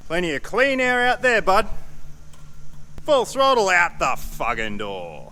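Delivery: high, loud send-off; playful yell. Real, not acted. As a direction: shove it out with gusto. Throwing part out.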